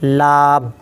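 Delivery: लाब said with the nose pinched closed; नाक बंद करके लाब; nose held shut. Shown as a demonstration. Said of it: "नाक को बंद कर लें और बोलें".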